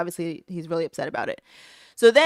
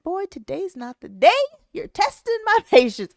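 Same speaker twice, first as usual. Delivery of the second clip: high retold scolding, laugh breaking in; mock-exasperated, climbing higher with a laugh; fed-up mother; 10 semitones higher than usual. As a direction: retell a mom at the end of her patience, pitched up.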